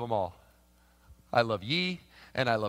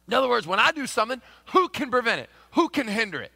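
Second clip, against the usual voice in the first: louder, higher preaching build; 6 semitones higher, 6 dB louder than usual. Acted. Pastor preaching the verse to the congregation, louder and higher. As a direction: drive it home from the pulpit. Preaching the text at full force.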